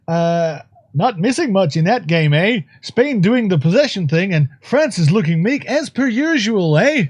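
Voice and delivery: mock Canadian reporter, only lightly shifted; understated Canadian sports pundit; Canadian accent. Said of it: "best Canadian accent I could do".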